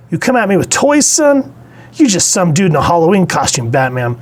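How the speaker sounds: high, taunting trash talk; cocky Shazam. Retold or imitated. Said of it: "dialogue that they do give Shazam".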